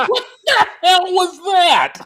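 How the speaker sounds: high, laughing shocked question; laughing disbelief. Retold or imitated. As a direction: squeal it out through laughter. Narrator's own reaction, laughing.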